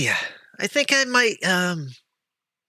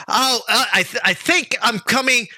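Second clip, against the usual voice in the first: nervous; 10 semitones higher than usual. Acted. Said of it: "and she goes".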